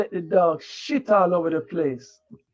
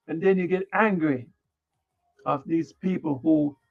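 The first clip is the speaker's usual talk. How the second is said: ordinary talk, nothing marked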